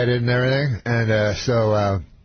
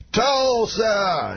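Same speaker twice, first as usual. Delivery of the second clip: high, drawn-out stage call; Bill Cosby; 10 semitones higher than usual. Retold or imitated. Star greets cheering crowd, mimicked high drawn-out call. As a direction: mimic a star hailing the crowd, long vowels. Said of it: "every time slower".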